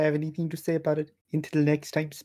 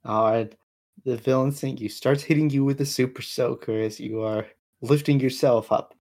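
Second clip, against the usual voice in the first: normal talking voice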